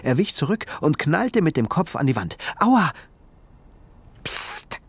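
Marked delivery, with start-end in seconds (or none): 2.6-2.9 sharp high ouch as his head hits; pained yelp. A narrator's reading. Ben hits his head on the wall, yelping. 4.2-4.8 voiceless sharp shush; scolding hiss. A narrator's reading. Tiny creature hushing Ben, a scolding hiss. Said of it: "schimpfte die winzige Kreatur".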